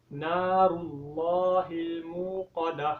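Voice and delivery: slow melodic recitation; Quran recitation. Shown as a demonstration. Teacher recites verse six for the class, slow chant. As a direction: chant it slowly.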